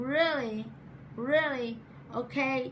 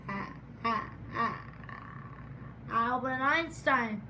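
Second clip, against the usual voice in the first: stuttering; 3 semitones lower, 3 dB quieter than usual. Real, not acted. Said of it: "as another stutterer".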